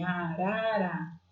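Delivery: "Arara" drawn out slowly, syllable by syllable; syllable counting. Shown as a demonstration. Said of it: "quantas sílabas tem".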